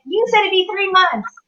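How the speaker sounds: high-pitched retold protest; surprised objection. Customer to guitar hospital caller, surprised protest. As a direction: raise the pitch in disbelief. Acts out surprise at early call.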